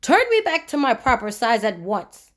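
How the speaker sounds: plain reading voice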